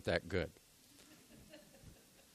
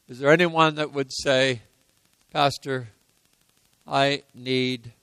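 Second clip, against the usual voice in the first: altar call; 6 dB louder than usual. Acted. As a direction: gently lead the congregation into prayer.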